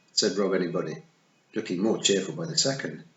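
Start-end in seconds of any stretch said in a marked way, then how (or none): none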